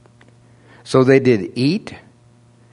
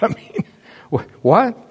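mock bewilderment; 11 semitones higher than usual.

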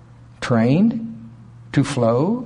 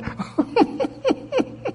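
high, softer stifled giggle, acted out; muffled laugh; 5 dB quieter than usual. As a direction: imitate someone trying to smother a laugh. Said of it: "He probably tried to muffle it".

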